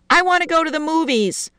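put-on high-pitched little girl's demand; insistent child. A narrator's reading. Child protesting in the story.

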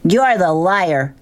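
mild acted shout, slow and high; mock shout. A narrator's reading. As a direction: hurl it back with a restrained yell. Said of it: "she shouted from the back seat".

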